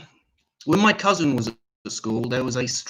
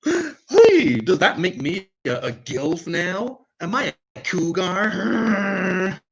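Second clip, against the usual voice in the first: high, drawn-out mock cougar voice; mock predatory woman; 6 semitones higher, 5 dB louder, much slower than usual. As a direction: ham up a woman asking what she is, slow and high. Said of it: "It's like".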